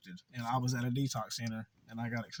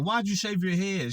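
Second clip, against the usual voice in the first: higher, louder incredulous question; shocked question; 7 semitones higher, 4 dB louder than usual. Retold or imitated. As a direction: blurt it out, amazed. Asking bald girl in shock.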